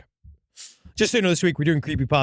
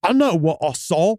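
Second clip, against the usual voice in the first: put-on measured British-accented insistence; restrained first take, British accent. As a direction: say it firmly in a put-on English accent. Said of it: "it almost comes across different when in that accent".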